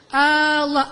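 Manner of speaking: Allah with the A held long; long questioning A. Shown as a demonstration. Preacher repeating the long A demo for listeners. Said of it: "A itu apakah?".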